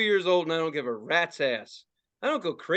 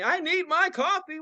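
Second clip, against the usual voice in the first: mock coffee drinker; 11 semitones higher than usual. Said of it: "People got cigarettes and caffeine".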